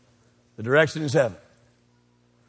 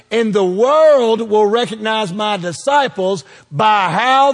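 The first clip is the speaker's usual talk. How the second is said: rising, louder, slow proclamation; preaching God's words; 7 semitones higher, 4 dB louder, slower than usual. Acted. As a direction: build it to a climax. Voicing God, building preaching.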